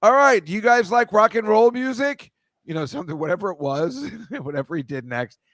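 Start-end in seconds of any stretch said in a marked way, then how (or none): 0.0-2.3 high, loud rock-star call to the crowd. Retold or imitated. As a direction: pump up the arena. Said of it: "all of a sudden he goes".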